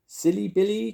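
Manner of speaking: high, loud amused granny voice; chuckling Grandma. A narrator's reading. Grandma amused by Willy's name, high chuckly tease. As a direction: tease in a high old-lady voice. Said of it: "chuckled Grandma Bar".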